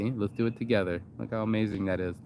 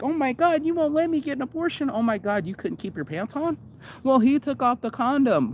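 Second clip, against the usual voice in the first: much higher, whiny outraged mimicry; shrill mock outraged woman. Mocking people shocked by pregnancy, high whiny outraged voice. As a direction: squeal it like a shocked, indignant woman. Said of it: "everybody's shocked, like".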